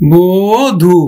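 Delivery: বধূ slowly as "booo-dhu", long rounded o; teacher's slow "bo... dhu" for বধূ; drawn-out O sound. Shown as a demonstration. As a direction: stretch the first syllable of বধূ into a closed o. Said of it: "ও এর মতো উচ্চারিত হবে".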